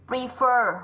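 "Prefer" with a long, strong ơ at the end; "Prefer" stressed on -FER, vowel held long; long final vowel. Shown as a demonstration. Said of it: "là âm ơ dài".

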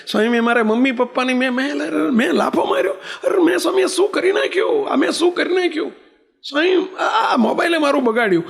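high-pitched, anguished cry of regret; retold sobbing plea. Swami voices the weeping boy confessing he slapped his parents, anguished high cry. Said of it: "ધ્રુસકે ને ધ્રુસકે ... એટલો રડ્યો".

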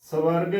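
"ਸਵਰਗ" split as "sa-varg", with a vowel after s; mispronunciation. Shown as a demonstration. Teacher warns of wrong pronunciation, "ਸਵਰਗ" said "sa-varg". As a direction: sound the wawa separately in "ਸਵਰਗ": "sa-varg". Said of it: "ਤਾਂ ਗਲਤ ਉਚਾਰਨ ਹੋਵੇਗਾ".

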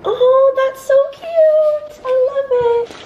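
very high, loud, slow gushing; delighted squeal. Real, not acted. Opening gifts.

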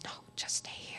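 soft, secret whisper; whispered advice. Retold or imitated. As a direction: hush it so the boss won't hear. Lady whispering she can stay.

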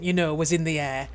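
ordinary delivery

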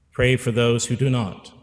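ordinary talk

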